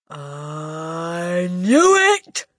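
swelling, very slow bellow. A narrator's reading. Headmistress swelling up with rage, drawn-out bellow. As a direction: let it build and burst out loud. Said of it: "she bellowed".

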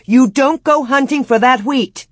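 high, loud, emphatic command; forceful forbidding. A narrator's reading. Wife forbidding her husband to hunt for wheat, forceful. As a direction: lay down the law, insistently.

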